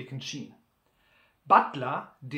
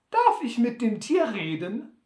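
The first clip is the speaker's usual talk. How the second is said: Sam harmlos; 6 semitones higher, 5 dB louder than usual. Acted. Sam fragt.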